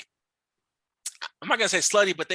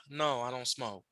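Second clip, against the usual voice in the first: quiet reply; 5 semitones lower, 10 dB quieter, slower than usual. Retold or imitated. His own quoted answer.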